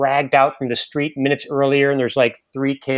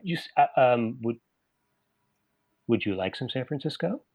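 nervous. Retold or imitated. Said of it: "he looks very nervous".

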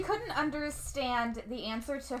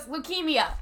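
one-word reveal, slightly raised pitch; smiling blurt; 4 semitones higher than usual. Retold or imitated. Grandpa smiles and just tells Vicky, smiling blurt. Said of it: "he smiles and just goes".

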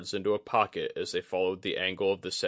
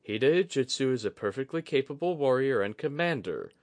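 grimacing; 6 semitones higher than usual. A narrator's reading. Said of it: "he said with a grimace".

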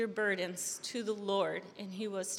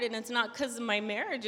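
usual speaking voice

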